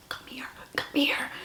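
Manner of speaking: put-on hushed child calling her over; whispered come here; kid's whisper. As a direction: whisper it like a shy little kid. Kid calling her over secretly.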